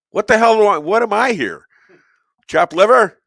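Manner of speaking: mock outrage. Comic host complains about being ignored, mock outrage.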